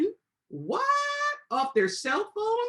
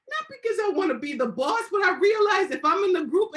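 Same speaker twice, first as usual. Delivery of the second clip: excited outburst; 10 semitones higher, 6 dB louder than usual. Real, not acted. Laughing self-defense, raised voice.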